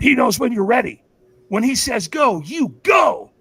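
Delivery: high, loud retold instruction, not whispered; intense urging. Stranger primes speaker waiting in line, intense. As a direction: press the message home, building to a shout. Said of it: "he whispers in my ear".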